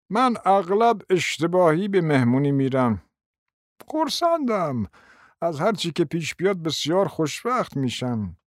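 a hint of a doddering prince; old prince. A narrator's reading. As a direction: play a rambling elderly prince. Narrator voices old prince.

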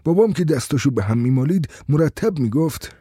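plain reading voice

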